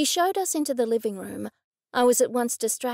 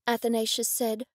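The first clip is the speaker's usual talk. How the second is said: ordinary delivery, nothing marked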